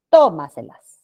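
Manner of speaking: "Tómaselas" with a loud TÓ, the rest falling away; stress on TÓ. Shown as a demonstration. Teacher showing a sobresdrújula word, loud stress on TÓ in "Tómaselas". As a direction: hit the first syllable of "Tómaselas" hard. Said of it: "Sobre esdrújulas".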